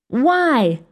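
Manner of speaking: a mild shout of challenge. A narrator's reading. Kid questions the plan from across room, mild shout. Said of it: "shouted one of the kids".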